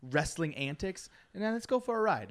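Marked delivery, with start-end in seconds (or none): 1.3-2.3 higher, building up excitement; revving up the story; hyped up. Real, not acted. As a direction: announce the ride with energy. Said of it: "bring it way back up".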